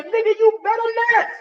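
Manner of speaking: high, fast little warning voice; squeaky hammed-up conscience; scolding inner voice. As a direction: nag yourself to stop. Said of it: "that voice that's bugging you is you saying".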